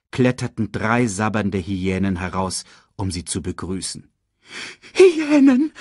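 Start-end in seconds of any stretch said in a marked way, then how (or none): 4.9-5.7 very high, loud cry of dread; horrified gasp. A narrator's reading. Sasu sees three drooling hyenas, gasping in horror. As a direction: gasp it out in panic. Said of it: "keuchte Sasu".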